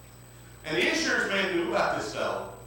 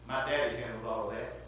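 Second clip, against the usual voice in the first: much lower and softer, calmly certain; hushed calm answer; 9 semitones lower, 6 dB quieter than usual. Acted. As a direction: drop right down and say it quietly.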